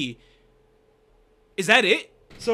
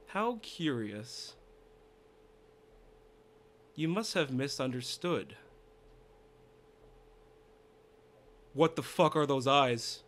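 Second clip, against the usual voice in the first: villain voice; 4 semitones lower, 7 dB quieter than usual. Acted. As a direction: intone it like a cold dark child. Reading villain text aloud.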